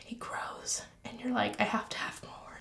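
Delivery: hushed, breathy obsessed whisper; creepy whisper. Acted. Gardener confessing her plant obsession, in a whisper.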